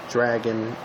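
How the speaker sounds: ordinary talk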